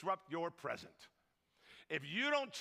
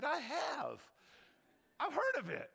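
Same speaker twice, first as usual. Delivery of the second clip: coy tease; 7 semitones higher than usual. Retold or imitated. Coy reveal to gossiping ladies.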